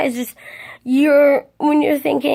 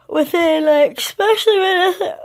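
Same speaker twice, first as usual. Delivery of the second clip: words pulled up high by a real yawn; 6 semitones higher than usual. Real, not acted. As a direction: talk through a yawn. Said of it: "I'm sorry I'm yawning so much".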